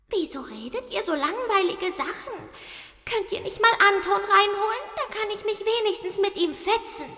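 very high, whiny little cat voice; high-pitched cartoon cat, impatient; grumbling cat. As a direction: complain as a bored, bossy cat. Cat's thoughts voiced in story.

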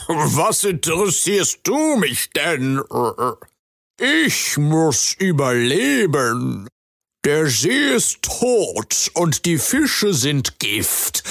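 a selfish frog justifying himself, slowly; sly frog creature. Acted. Frog creature's long reply.

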